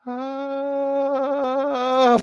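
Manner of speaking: high, drawn-out "ah"; long high falling scream; falling yell. Acted. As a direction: wail all the way down. Acts out diving from the sky.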